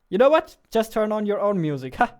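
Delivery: sudden high, loud burst; mock outburst. Game dev bursting out at chat, loud and high. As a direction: throw it at them like a sudden rant.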